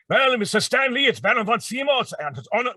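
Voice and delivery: a retold quick villain demo; Baron von Zemo voice. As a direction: ham up the villain for Stan. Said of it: "whatever I said".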